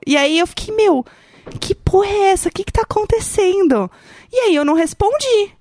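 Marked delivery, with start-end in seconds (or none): 0.7-3.9 high-pitched retold disbelief; baffled outburst. Woman recalling strange Italian messages, acting out baffled exasperation. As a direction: act out exasperated bewilderment, pitched up.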